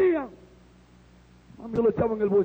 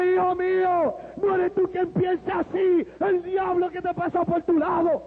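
higher, declaimed divine address; voice of the Lord; 5 semitones higher, slower than usual. Retold or imitated. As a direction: proclaim it solemnly as a heavenly figure. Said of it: "hablaba como el hombre que me llamó".

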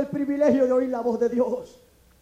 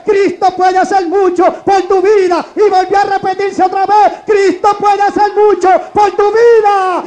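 shouted, high-pitched revival cry; thundering preacher, phrase after phrase; full-force preaching; 6 semitones higher, 15 dB louder, slower than usual. Real, not acted. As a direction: build to a roaring, drawn-out hallelujah.

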